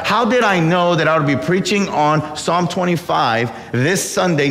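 usual speaking voice

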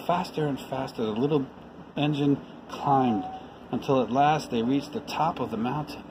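ordinary delivery, nothing marked

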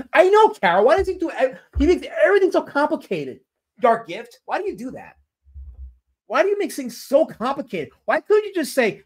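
high-pitched, exasperated complaint; exasperated rant. Real, not acted. Streamer scolds Dark Gift for complicating things, exasperated rant. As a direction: vent your exasperation, pitch way up.

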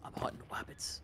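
hushed hunter's whisper, no voice at all; Elmer Fudd whisper. Pretend. Friend whispers a cartoon hunter line to the squad.

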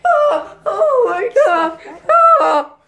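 high, loud screams with crying; hammed-up horror screams and sobs; terrified screaming. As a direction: scream as if being attacked. Attack scream continues.

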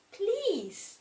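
lightly voiced gentle mum; mum's voice. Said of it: "his mum used to tell him".

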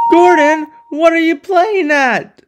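indignant high yell; high angry shout. Acted. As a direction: shout in shock at the reckless engine. Henry shouts at speeding Gordon.

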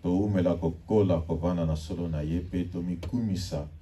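usual speaking voice

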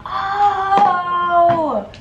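high, slow "Oh!" of discovery; sudden realization. Real, not acted. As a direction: gasp it out like you just found it. Suddenly finds the missing part.

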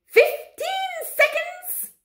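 very high, drawn-out countdown yell; yelling witch. A narrator's reading. Grand High Witch counting down onstage, yelling. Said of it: "yelled the Grand High Witch".

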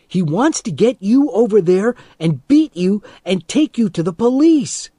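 high, loud pleading warning; urgent shout. A narrator's reading. Friend warns Teddy of the trap, shouting. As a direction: yell the warning, rising to the end. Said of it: "I shouted in his ear".

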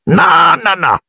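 very high, frantic elf squeak; squeal. A narrator's reading. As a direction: squeal the refusal. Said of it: "squittì Dobby scuotendo la testa". (squittio acutissimo da elfo)

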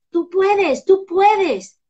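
high, loud repeated cheer; encouraging. Shown as a demonstration. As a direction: pump the kid up. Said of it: "hay que decir, tú puedes". (ánimo entusiasta, agudo y fuerte)

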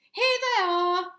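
high, loud, drawn-out triumphant cry; slow, high-pitched shout of discovery; excited discovery. A narrator's reading. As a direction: call it out like an excited child. Oliver rushes out, finds potatoes.

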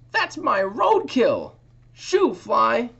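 high, loud, slow squawk; bird squawking. Acted. Bird squawking at the fly over its roadkill, high loud squawk. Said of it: "A bird squawked".

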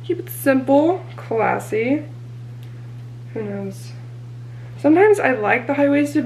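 normal talking voice

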